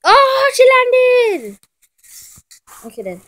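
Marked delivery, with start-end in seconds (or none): 0.0-1.6 high, drawn-out thrilled exclamation; excited cry. Pretend. Kid cheering a classmate's landing, thrilled and slow. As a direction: cheer her landing loudly and slowly.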